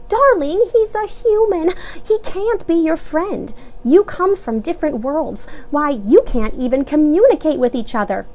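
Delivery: high, gentle but firm; mother voice. A narrator's reading. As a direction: explain patiently. Mother explains firmly.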